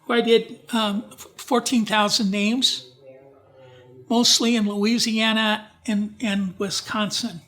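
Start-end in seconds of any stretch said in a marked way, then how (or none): none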